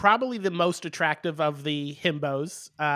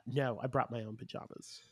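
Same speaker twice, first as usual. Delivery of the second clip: soft, low, odd mimicry of Daniel's speaking voice; Daniel's weird voice; 5 semitones lower, 11 dB quieter than usual. Co-host mocks a reality contestant's weird voice, quiet odd imitation. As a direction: mimic his strange way of talking, much softer. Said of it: "He has a very weird speaking voice".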